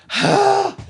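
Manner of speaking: big audible huff of breath; exasperated sigh. Real, not acted. Host worn out by taking toys from his wife, exasperated sigh. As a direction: heave a high, heavy sigh.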